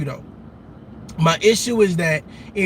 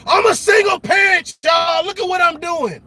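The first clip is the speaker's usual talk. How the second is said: mock boast; 7 semitones higher than usual. Mocking a proud single parent.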